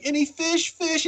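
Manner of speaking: very high, pleading mock voice; imitated beggar. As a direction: beg for scraps in a tiny high voice. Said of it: "got her hand out like a beggar".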